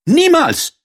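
hoher, lauter Ausruf der Prinzessin; laut und hoch rufen, entschieden. Acted. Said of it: "rief die Prinzessin". (die Prinzessin weigert sich vor König und Frosch, laut rufend)

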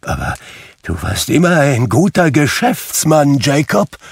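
faltering start, then high, loud protest; hesitate, then blurt it out with mild trembling; trembling stammer. A narrator's reading. Scrooge reassuring the ghost nervously, stammering a little. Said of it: "stotterte Scrooge zitternd".

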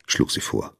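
plain reading voice

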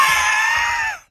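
loud high burst of laughter. Acted. Breitling laughing at his own joke, loud laugh. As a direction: bark out a laugh. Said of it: "lachte Breitling".

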